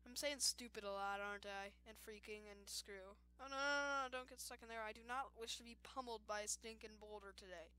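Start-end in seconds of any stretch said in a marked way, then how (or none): none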